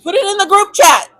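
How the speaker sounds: high, bright call to the group; mock group chat call. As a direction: sound the alarm to the sisters. Voicing advice to sisterhood group.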